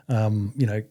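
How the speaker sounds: ordinary talk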